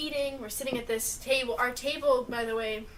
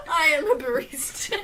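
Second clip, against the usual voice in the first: laughing, louder mock announcement; laughing mimicry; 4 semitones higher, 9 dB louder than usual. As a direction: parrot it back while cracking up. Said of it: "I am no longer a cash register".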